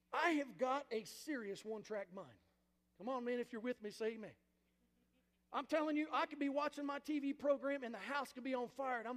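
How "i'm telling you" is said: usual speaking voice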